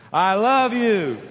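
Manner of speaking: loud, slow, drawn-out declaration of love; passionate. Shown as a demonstration. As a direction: belt it out with great passion. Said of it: "Passion. You ready? Passion".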